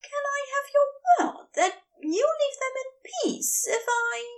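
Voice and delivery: a worried angel asking, unsure; high halting plea with a catch; faltering angel. A narrator's reading. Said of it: "Aziraphale falters".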